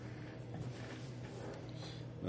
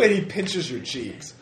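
laughing narration; 6 semitones higher, 6 dB louder than usual. Real, not acted.

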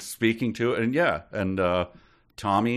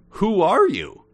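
high, louder demand; challenging question; 8 semitones higher, 6 dB louder than usual. Acted. Acting Pongo challenging the voice.

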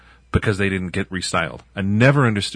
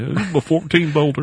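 loud, twangy Southern hillbilly voice; hillbilly Southern accent; 9 semitones higher, 5 dB louder than usual. Retold or imitated. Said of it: "It's total hillbilly".

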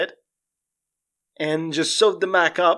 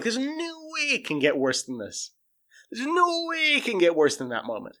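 mock disbelief; 6 semitones higher, 4 dB quieter than usual. Viewer sure the story has peaked, mock disbelief. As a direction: stretch NO WAY up high in disbelief.

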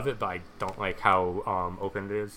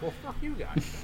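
exasperated. Retold or imitated. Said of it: "and I'm like".

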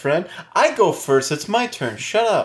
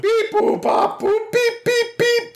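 robot beeps; slower than usual. Pretend. Hits the show's button.

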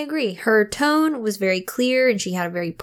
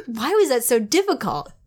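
ordinary delivery

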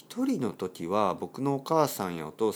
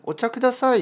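customer ordering tea; 13 semitones higher, 6 dB louder than usual. Retold or imitated. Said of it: "って言うと".

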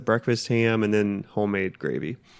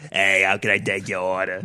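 smoker woman voice; 3 semitones lower than usual. Retold or imitated. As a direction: lower raspy waitress. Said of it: "the Waffle House ladies are always like".

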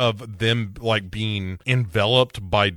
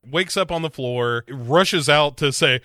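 ordinary delivery

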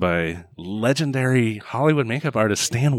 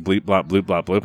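ordinary talk, nothing marked

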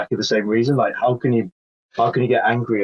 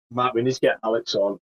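ordinary delivery

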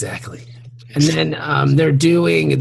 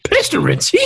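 Scooby-Doo; 2 semitones higher than usual. Acted. Co-host casts Scooby as a Horseman, cartoon dog. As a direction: play the talking dog, ending in a long giggle. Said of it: "Scooby as one of the Four Horsemen".

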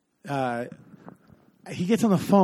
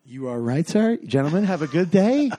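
hammed-up, eager agreement to get out; mock over-politeness; 3 semitones higher, 4 dB louder than usual. Passenger excuses himself from the dealers' car, exaggerated politeness.

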